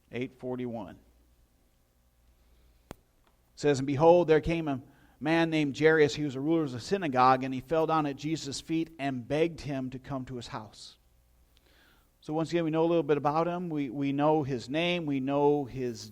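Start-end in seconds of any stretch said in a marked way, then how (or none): 3.8-11.0 reading the verse out with weight; scripture reading. Acted. Pastor reads Luke to the congregation, declaiming. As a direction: lift the Bible words to the room.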